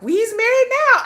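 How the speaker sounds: high, loud, drawn-out movie-quote voice; hammed-up Celie line from The Color Purple. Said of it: "'Cause we like The Color Purple".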